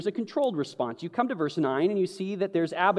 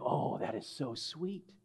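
cooing; 12 dB quieter than usual. Retold or imitated. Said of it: "you're like".

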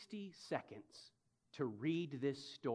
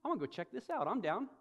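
much higher, bouncy resolve; eager decision; 10 semitones higher, faster than usual. Retold or imitated. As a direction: talk yourself into it, playfully. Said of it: "and I'm like".

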